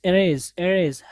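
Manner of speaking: "erase" starting with a light ee; teacher showing the ee in "erase"; light ee sound. Shown as a demonstration. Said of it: "हल्का सा आप ई की आवाज़ निकालेंगे".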